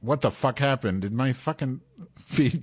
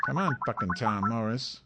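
ordinary talk, nothing marked

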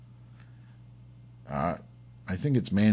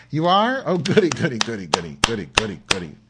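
gleeful; 6 dB louder, faster than usual. Real, not acted. Hearing the song is coming.

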